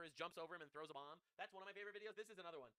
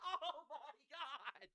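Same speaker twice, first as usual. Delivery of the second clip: slow, laughing exclamation; delighted laughing disbelief; 6 semitones higher, much slower than usual. Real, not acted. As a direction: crack up at the sight. Laughing at the clip.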